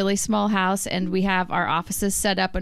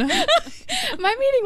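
high and slow through real laughter; laughing; much slower than usual. Real, not acted. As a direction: squeeze the words out while cracking up. Laughing at pajama Zoom story.

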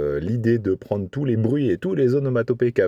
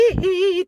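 very high squeaky hee-hee; [name] hee-hee. Retold or imitated. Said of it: "tous les hi".

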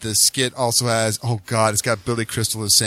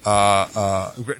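ordinary talk

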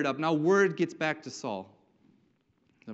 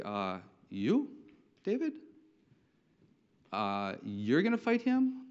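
incredulous; 4 semitones lower, slower than usual. Acted. Saul to young David who wants to fight, incredulous. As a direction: question the kid as if you can't believe it.

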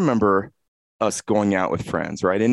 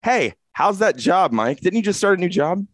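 oblivious repeat question; 10 semitones higher than usual. Retold or imitated. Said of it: "And then thirty seconds later".

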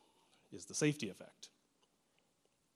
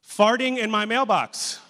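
higher, louder, slow and amused; incredulous read-out; 9 semitones higher, 12 dB louder, slower than usual. Acted. Pastor reads a silly neighbor post aloud, higher and amused. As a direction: savor the ridiculous headline. Reading absurd Nextdoor post.

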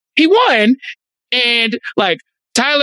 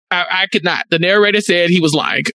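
ordinary talk

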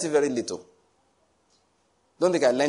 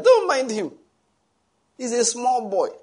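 indulgent God; 10 semitones higher, 4 dB louder, slower than usual. Retold or imitated. Preacher voicing God laughing at people.

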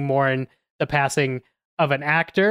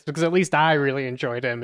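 normal talking voice

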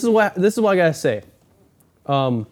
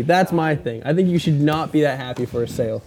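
ordinary delivery

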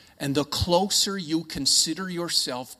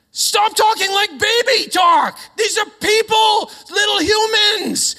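very high, loud, drawn-out yell; hammed-up outburst, hit every word hard; exasperated mock shout; 9 dB louder, slower than usual. Acted outburst at baby-talking parents.

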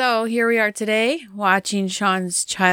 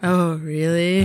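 mock sexy echo; slower than usual. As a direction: drawl it back like a sultry tease. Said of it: "so sexy now".

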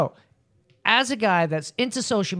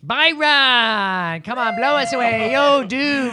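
loud, drawn-out hype call; 5 semitones higher, 3 dB louder, much slower than usual. Real, not acted. Host summons Byron to the mic, calling out. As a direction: call the guest up, stretching the name high and loud.

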